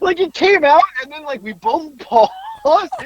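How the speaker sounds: high, slow, laughing; real laughter. Real, not acted. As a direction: drag it out through laughter. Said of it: "still laughing".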